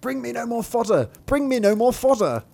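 high-pitched pleading cry; shouting. Acted. Thumbling crushed by hay in the cow, shouting shrilly. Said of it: "and he shouts".

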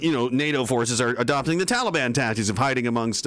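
ordinary talk

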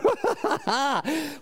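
high delighted laugh. Real, not acted. Preacher laughing at his own story, delighted laugh.

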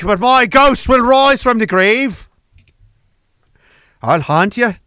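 mock-epic Irish proclamation; Irish accent. Clansman threatens to haunt his killers, grand Irish accent. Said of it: "that was Ireland".

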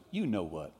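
low, hushed punchline; cautious parrot keeping it down; sly parrot. Acted. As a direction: drop it quietly, knowingly. Parrot's sly punchline after being threatened.

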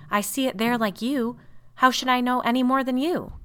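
loud, high protest; exasperated retort. A narrator's reading.

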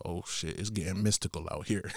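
wary aside. Retold or imitated. Visitor told to follow the brick path, quietly wary. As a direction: say it softly to yourself. Retold private reaction to odd directions.

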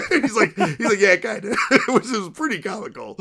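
can barely get the words out for laughing; laughing through it. Real, not acted. Retelling the guard's reply, laughing while quoting it.

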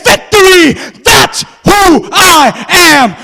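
loud, drawn-out triumphant shout; victory scream. Acted. David screams victory over the fallen giant. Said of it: "turn around and scream and say".

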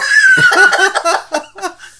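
big high burst of laughter; loud laugh. Real, not acted. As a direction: crack up loudly. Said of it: "big laugh".

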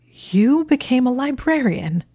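high, loud disbelief; incredulous, high-pitched questioning; bewildered. A narrator's reading. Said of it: "in a bewildered voice".

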